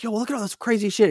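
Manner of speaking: high, quick mock urgency; retold alarm. Player to co-op friend in an argument, mock alarm. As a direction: re-enact urging a friend to look. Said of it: "start to have an argument".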